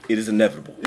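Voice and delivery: low, ominous pronouncement; Thanos quote. Acted. Thanos declaring his doom, low and grave. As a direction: intone it low and final.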